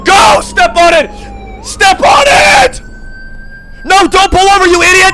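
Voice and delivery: loud, high frantic shouting; panicked yelling. Real, not acted. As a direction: yell at the driver in panic. Yelling at driver in chase.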